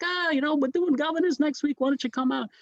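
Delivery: very high-pitched impression of a comic; Richie Menavini. Comic invites a newcomer to Governors, high-voiced impression. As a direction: put on that high voice. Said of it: "He does that high voice".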